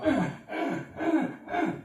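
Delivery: high, gasping grunts like winded; breathless grunts. Retold or imitated. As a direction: gasp out short grunts, no air. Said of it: "sitting there going".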